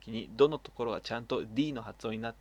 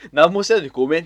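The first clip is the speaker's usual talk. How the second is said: louder, sheepish mock apology; 4 semitones higher, 16 dB louder, faster than usual. Apologizing to co-host for hogging the letter, loudly. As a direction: blurt out a fake sorry. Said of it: "っつって".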